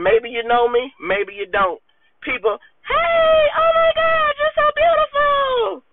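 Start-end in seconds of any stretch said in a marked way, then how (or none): 2.9-5.8 fake gushing. Mocking fake flattery from people online, a high gushing squeal. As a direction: squeal it much higher, sugary and fake.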